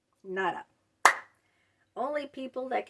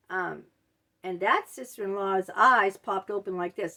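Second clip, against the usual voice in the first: ordinary delivery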